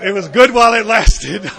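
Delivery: loud laugh through the words. Real, not acted. To the crowd, laughing about ruining smoking for newcomers. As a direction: burst out laughing at the joke. Laughing at his own joke.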